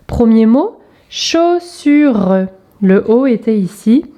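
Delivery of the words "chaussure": higher, drawn-out "chaussure"; segmented word for young readers; syllable by syllable. Shown as a demonstration. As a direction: split "chaussure" into long, held syllables.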